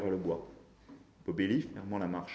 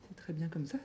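put-on high, soft old-lady voice; soothing grandmother; 7 semitones higher, 8 dB quieter than usual. A narrator's reading. Grandmother excusing his missing shirt, soothing. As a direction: reassure him softly, pitched high. Grand-mère rassure poliment le désaxé.

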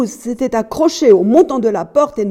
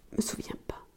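barely audible whispered excuse; cowed whisper; 19 dB quieter, faster than usual. A narrator's reading. Wife dodging her husband's question about the key, cowed whisper. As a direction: shrink into a tiny whisper.

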